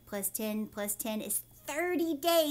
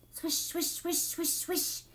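brisk swish sounds, a bit higher; quick swishes; 4 semitones higher, 3 dB quieter than usual. A narrator's reading. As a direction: zip through the swishes. Boat circles fast.

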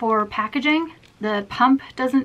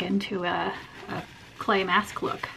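usual speaking voice

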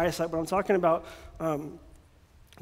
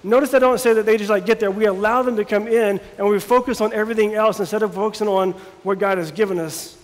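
louder preaching with rising fervour; full-force preaching; 6 dB louder than usual. Acted. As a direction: preach it out to the congregation.

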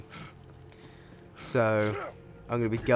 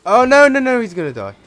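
much higher, much louder panicked cry; panicked yell; 11 semitones higher, 14 dB louder than usual. Real, not acted. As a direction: scream in panic as he's about to die. Teammate about to die in game.

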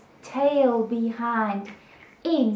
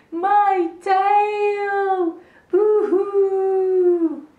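high, loud mock wailing; hammed-up crying; 9 semitones higher, 7 dB louder, much slower than usual. Gecko mourning his lost tail, in hammed-up wails. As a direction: bawl it in long, singing wails. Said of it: "he cried".

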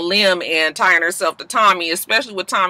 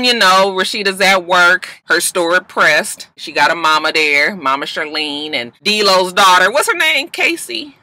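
normal talking voice

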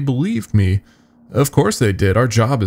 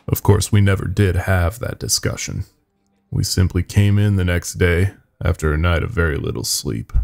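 plain reading voice

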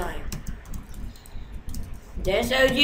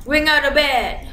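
loud, higher burst; excited shout; 6 semitones higher, 8 dB louder than usual. Real, not acted. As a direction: call it out loudly. Calling out during play.

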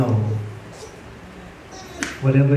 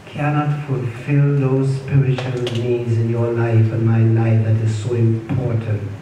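ordinary delivery

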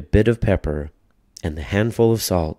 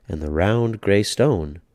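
ordinary delivery

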